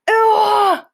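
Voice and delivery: high, loud anguished cry from a giant; a nightmare-struck giant howls out; giant's high cry. A narrator's reading. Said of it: "roared the flesh lump eater".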